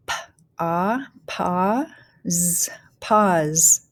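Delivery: "pause" built up sound by sound, then whole; teacher blending "pause" with gaps between sounds; phonics blending. Shown as a demonstration. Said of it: "go ahead and start blending".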